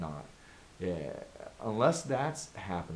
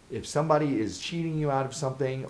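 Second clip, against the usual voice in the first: ordinary talk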